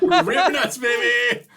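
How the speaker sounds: very high, laughing squeal; gleeful whoop. Pretend. Podcaster hollering a joke episode name to co-hosts, gleeful.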